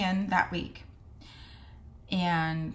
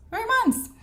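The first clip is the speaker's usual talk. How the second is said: high-pitched, louder exclamation of disbelief; incredulous repeat; 5 dB louder than usual. Real, not acted. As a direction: jump way up in pitch, aghast at the wait. Repeats the booking wait in disbelief.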